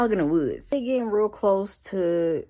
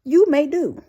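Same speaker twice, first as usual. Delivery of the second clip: high, loud, measured pronouncement; raised-pitch verdict from the riddle master; riddler granting permission; 7 semitones higher, 7 dB louder than usual. Acted. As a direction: grandly grant approval in a raised voice. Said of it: "And he says".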